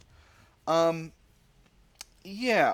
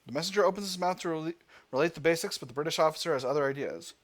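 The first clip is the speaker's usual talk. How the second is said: louder, higher dramatic event narration; story narration; 4 semitones higher, 4 dB louder than usual. A narrator's reading. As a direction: set the scene like a storyteller. Reads event narration aloud.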